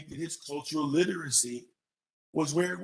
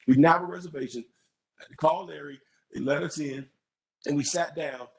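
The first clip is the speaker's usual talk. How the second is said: normal talking voice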